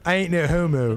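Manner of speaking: mock Philly accent, laughing through it. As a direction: toss it off in a joking Philadelphia accent. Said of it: "can I say it in my best Philly accent?".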